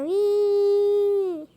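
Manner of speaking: high, loud cheer; joyful whoop. Real, not acted. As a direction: cheer with delight. Celebrating success. (صيحة فرح عالية)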